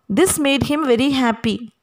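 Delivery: ordinary talk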